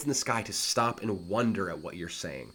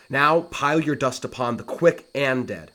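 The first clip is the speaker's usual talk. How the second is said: louder, dramatic reading of the grieving brother; theatrical Shakespeare delivery; declaimed verse; 4 semitones higher, 8 dB louder than usual. Acted. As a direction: proclaim it over the grave. Teacher performs Laertes' line.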